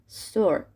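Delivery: "استور" as s-tor, no vowel first; correct English s cluster. Shown as a demonstration. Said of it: "تلفظ درستش اینجوریه". («استور» بدون اِ، شروع با س)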